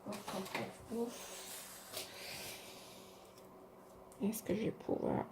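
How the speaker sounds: breathy effortful puffing; grunting while forcing it open; straining effort. Real, not acted. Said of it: "j'arrive pas à l'ouvrir".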